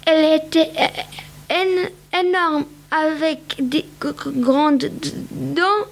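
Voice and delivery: a shaken, halting stammer, slow on the hard consonants. A narrator's reading. Rabbit recounting the giant carrot, stammering with fright. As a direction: still trembling, he trips over every word. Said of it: "il bégayait".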